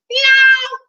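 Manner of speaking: high mewing cat call; cat meow. Acted. Lost cat calling from the basement, high meow. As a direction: mew like a small cat.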